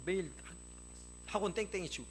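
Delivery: put-on parent telling off a teenager; mock scolding. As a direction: act out a parent's rebuke. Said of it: "꾸중하는 거예요".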